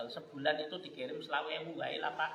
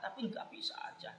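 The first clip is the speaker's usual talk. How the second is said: sudden whisper; 6 semitones higher, 8 dB quieter than usual. Real, not acted. Preacher confiding his failure to the congregation, whispered. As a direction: drop to a breathy whisper. Admits he still couldn't register them.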